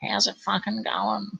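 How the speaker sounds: imitating a friendly old lady; sweet old lady. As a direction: put on a little nana voice. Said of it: "she just comes up and says".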